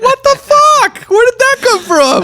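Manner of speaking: very high-pitched, squeaky mock outrage; mock disbelief. Imitating the guest blindsided by the question, mock disbelief. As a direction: send the voice way up, hammed-up bewilderment. Acting out guest's shocked reaction.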